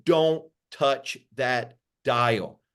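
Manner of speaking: very slow, word by word; slow, emphatic. Acted. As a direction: draw out each word of "don't touch that dial". Slogan repeated slowly for emphasis.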